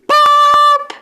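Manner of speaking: high, loud sung beep like an alert tone; mock alert beep. Gamer imitating the broadcast alert tone, high loud beep. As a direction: beep like the emergency broadcast signal.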